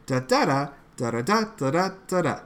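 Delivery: fast da da da rhythm beating out the line; scansion in da syllables. Shown as a demonstration. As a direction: rattle off the line's beat on da. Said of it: "the way that I scan it".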